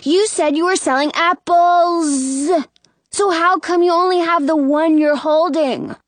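put-on high, indignant kid's voice; insisting. A narrator's reading. Brother challenging the apple seller, insisting. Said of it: "Jonah insists".

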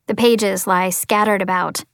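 plain reading voice

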